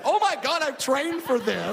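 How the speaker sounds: high, loud burst, then drawn out; thrilled to finally use the training; hammed-up delighted exclamation; giddy excitement. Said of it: "I'm almost excited to be mugged".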